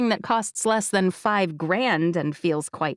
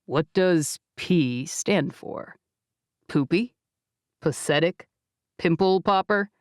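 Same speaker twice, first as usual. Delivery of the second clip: Jake's texts; 3 semitones lower than usual. Acted. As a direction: tease her with silly P words.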